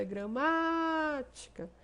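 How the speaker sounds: stretched third-to-last syllable in gramática; stress on MÁ. Shown as a demonstration. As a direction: hold the MÁ of gramática, then drop off. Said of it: "encontra-se na antepenúltima sílaba".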